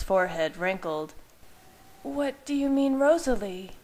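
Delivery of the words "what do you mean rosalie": cautious. Acted. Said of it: "her voice cautious".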